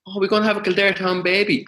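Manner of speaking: high, bright mock-cheerful quip; husband joking to his wife in labour, upbeat and high; jokey announcement. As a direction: toss out the joke a bit too lightly. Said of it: "So I said to her".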